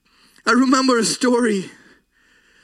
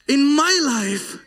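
usual speaking voice

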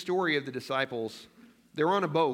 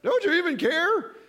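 much higher, louder, shrill-free squeaky panic; panicked disciples; 7 dB louder, slower than usual. Retold or imitated. Preacher mimicking disciples shouting at sleeping Jesus, high squeaky panic. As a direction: squeal it like terrified men waking someone. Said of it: "that's how they woke him up".

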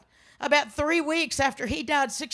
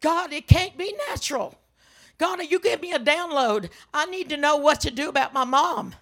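retold urgent prayer; 3 semitones higher, 4 dB louder than usual. Daughter begs God for help with mom, urgent plea. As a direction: press God hard for an answer.

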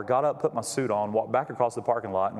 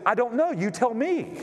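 baffled shrug; 11 semitones higher than usual. Retold or imitated. Visitor can't explain.

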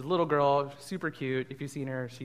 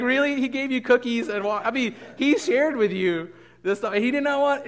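much higher and louder, outraged disbelief; shocked; 10 semitones higher, 10 dB louder than usual. Retold or imitated. Babysitter reacting to a four-year-old refusing to share, shocked. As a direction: blurt it out in stunned disbelief. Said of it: "like, shocked".

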